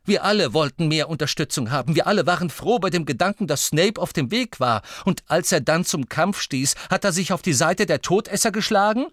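high, loud and insistent; urgent figure. Acted. Voices urgent dialogue.

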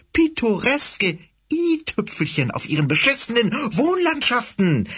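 high, sneering villainous cat. Acted. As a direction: taunt him like a fanatic cat leader.

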